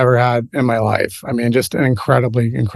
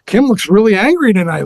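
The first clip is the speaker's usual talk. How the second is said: retold intimidated awe; 6 semitones higher than usual. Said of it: "It was scary".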